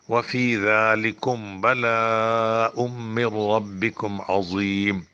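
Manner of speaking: measured, slow recitation, each word clear; slow teaching recitation; slow Quran recitation. Shown as a demonstration. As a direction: recite the verse carefully for students. Recites the verse slowly.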